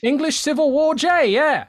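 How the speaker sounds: a time-travelling Englishman calling into the show; old-timey caller. Acted. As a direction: play a bewildered caller from the English Civil War. Caller from the English Civil War.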